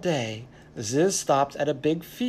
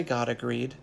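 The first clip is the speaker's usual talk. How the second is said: usual speaking voice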